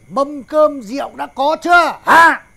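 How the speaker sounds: slow, loud, high bellowed demand; shouting. Acted. Father shouting to his daughter for the food and wine. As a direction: yell the order for wine.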